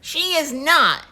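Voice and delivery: firm, drawn-out denial; insisting. Acted. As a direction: insist slowly, stubborn child. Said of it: "insisted Chris".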